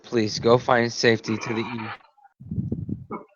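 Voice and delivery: low, soft, slow reassurance; calm her gently; gentle reassurance. Acted. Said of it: "I give her a soft hug".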